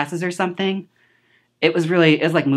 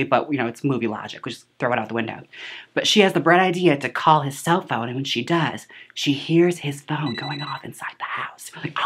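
normal talking voice

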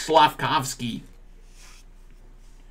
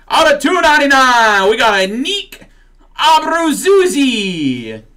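mock Brazilian accent; 8 semitones higher than usual. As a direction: drag the name out in a silly fake Brazilian accent. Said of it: "I'll start saying these with a Brazilian accent".

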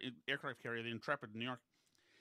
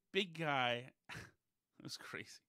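ordinary talk

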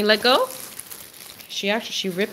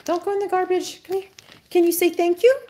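high pet-talk voice; 9 semitones higher, faster than usual. Real, not acted. Owner asking her dog to say thanks, in high pet talk.

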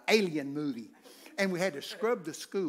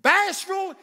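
bashful shot out high and loud; loud rebuke; 12 dB louder than usual. Real, not acted. Preacher scolding timid churchgoers, loud rebuke. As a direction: fire bashful at them like an accusation. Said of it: "preacher scolding the timid".

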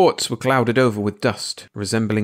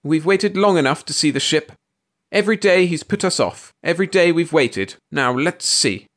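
high, urgent leader of a mob; rally the women, starting high and easing down; rallying cry; 7 semitones higher than usual. A narrator's reading. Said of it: "cried Missus Bellows, feeling like Madame Lafarge".

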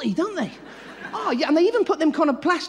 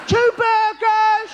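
slow, high shout into a speaker; shouted order; 8 semitones higher, 4 dB louder, much slower than usual. Acted. Customer shouting into drive-in speaker.

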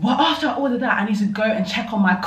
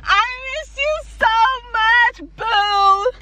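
gushing; 4 dB louder, much slower than usual. Real, not acted. Greeting her car after ten days away, high gushing coo. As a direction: coo it at the car like a reunited pet.

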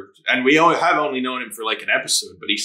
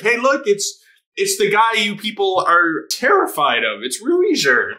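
voiced higher, a cheeky herald showing off the feared guy; pitched-up mock proclamation; mock announcing; 7 semitones higher than usual. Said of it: "being like".

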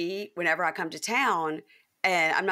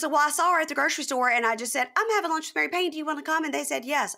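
high, bright, carefree explaining; breezy friend; 9 semitones higher, faster than usual. Retold or imitated. Mary Preston cheerfully explaining who she invited, high and breezy. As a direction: mimic the friend's cheery innocence.

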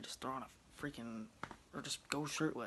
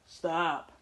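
short loud command, higher; snapping at the dog; 5 semitones higher, 14 dB louder than usual. Real, not acted. Owner snapping stop at his dog mid-story, loud. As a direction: cut off the dog with one sharp word.

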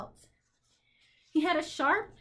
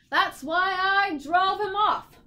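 loud, high, drawn-out bluster; fierce cry; 6 semitones higher, 5 dB louder, slower than usual. A narrator's reading. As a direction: boast loudly and fiercely. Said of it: "cried the humbug".

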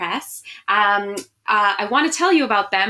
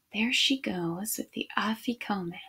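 whispered aside; 10 dB quieter than usual. Real, not acted. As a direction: lean in and breathe it like a secret. Reader's hushed aside over picture.

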